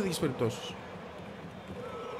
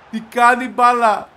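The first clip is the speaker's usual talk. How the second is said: awed repeat; 6 semitones higher, 10 dB louder, much slower than usual. Real, not acted. Gamer repeating stunned.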